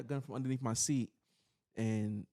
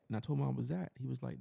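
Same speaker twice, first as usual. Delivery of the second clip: usual speaking voice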